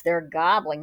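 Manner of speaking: ordinary delivery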